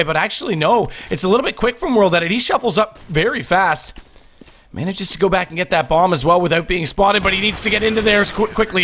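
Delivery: high, excited live call; esports caster. Acted. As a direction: call every shot as it happens. Live play-by-play of a fight.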